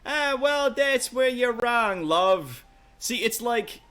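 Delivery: rough adventurer NPC. Acted. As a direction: brush the scholar off with a grin. Rough NPC answers the scholar.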